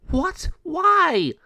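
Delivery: very high, loud disbelieving cry; a child refusing bad news; shocked protest. Mariana protests.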